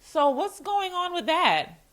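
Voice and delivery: high, slow, drawn-out fake-casual question; hinting manager. Manager hinting at a mistake to staff, slow high mock-casual voice. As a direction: imitate a boss circling the problem. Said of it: "that's how managers come at you".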